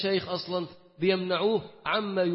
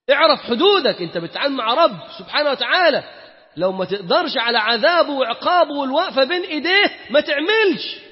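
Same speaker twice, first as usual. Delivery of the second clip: louder and higher, hammering the threat; stern warning; 6 semitones higher, 6 dB louder than usual. Real, not acted. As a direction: warn him sharply to know his place. تحذير شديد للسامع. (تحذير صارم بصوت مرتفع)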